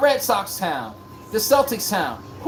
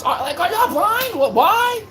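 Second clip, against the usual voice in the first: incredulous; 10 semitones higher, slower than usual. Real, not acted. Incredulous at fans.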